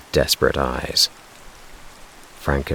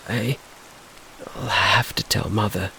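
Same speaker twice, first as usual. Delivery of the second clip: stammering; 6 semitones higher, 6 dB quieter than usual. A narrator's reading. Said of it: "stammered Slim".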